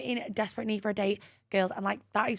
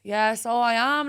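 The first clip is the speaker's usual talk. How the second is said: slow put-on Dublin lilt; Dublin accent; 4 dB louder, slower than usual. Girl fooling a guy in the queue, put-on Dublin accent. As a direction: answer in a broad Dublin accent. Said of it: "That's my Dublin accent".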